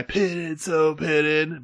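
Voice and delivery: lazy SoCal surfer stretching "pitted"; hammed-up Southern California surfer voice; SoCal surfer accent. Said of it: "the Southern California accent".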